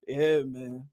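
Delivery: usual speaking voice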